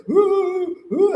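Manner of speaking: high mock wailing; imitating bawling. Retelling her first cold winter, mock crying high. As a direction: whimper it like a homesick teen. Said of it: "I was just bawling".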